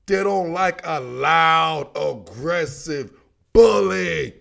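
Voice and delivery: slow, heavy word-by-word build to a punched "bully"; dramatic emphasis. Real, not acted. Host saying what the Chiefs don't like, dramatic emphasis.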